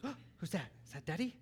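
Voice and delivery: hushed, soft imitation of an excited child; imitating Lillian. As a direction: gasp softly and wonder aloud like a child. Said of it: "And Lillian goes".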